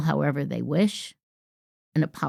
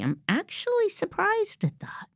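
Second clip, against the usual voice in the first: higher, exaggerated "I'm actually surprised at that" with "actually" leaned on; mock surprise; 13 semitones higher, 4 dB quieter than usual. Shown as a demonstration. Coach mimics overused "actually" with mock surprise. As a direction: play up false surprise. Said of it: "Using the word actually".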